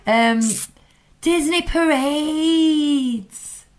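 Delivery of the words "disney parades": 1 wistful drawn-out. Acted. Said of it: "dreamy wrap".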